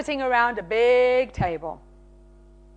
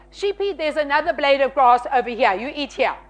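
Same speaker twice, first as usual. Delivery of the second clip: ordinary talk, nothing marked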